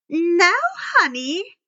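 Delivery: slow, high, sugary coo; honeyed old woman coo. A narrator's reading. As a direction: coo it like a fake-sweet teacher. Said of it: "Miss Dutz cooed".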